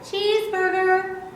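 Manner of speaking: high chirped "Cheeseburger"; chickadee call imitation. Shown as a demonstration. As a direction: sing "Cheeseburger" along with the bird. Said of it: "Can you hear it?".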